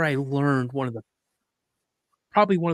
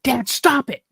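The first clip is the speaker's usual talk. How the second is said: high, louder mock yell; retold yell; 10 semitones higher, 5 dB louder than usual. Boy in deer stand tells dad to quit, retold yell. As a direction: yell at dad like an annoyed kid. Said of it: "I'm yelling for my dad".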